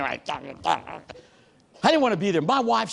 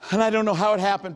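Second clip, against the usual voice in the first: normal talking voice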